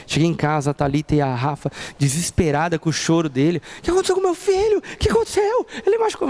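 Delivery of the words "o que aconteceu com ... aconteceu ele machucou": high, anxious mom voice; worried mother. Retold or imitated. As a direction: ask in a panic about her child. Worried mother asks about son.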